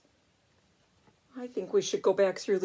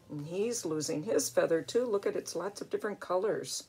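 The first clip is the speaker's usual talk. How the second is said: ordinary delivery, nothing marked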